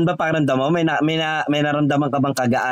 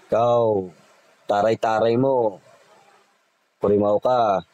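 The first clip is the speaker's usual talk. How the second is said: scornful scolding; 6 semitones lower, slower than usual. Acted. Healer scolds the spirit.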